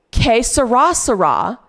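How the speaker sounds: "Que sera, sera" as kay suh-RAH suh-RAH, loud; pronunciation as read. Shown as a demonstration. Streamer showing the pronunciation she saw, loud suh-RAH. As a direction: punch RAH in each "sera", American r. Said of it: "as how to pronounce it".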